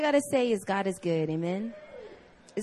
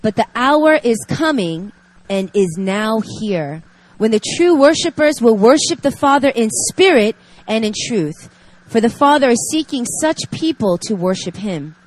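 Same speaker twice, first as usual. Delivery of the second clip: reading scripture; 4 dB louder than usual. Acted. Reads scripture passage aloud.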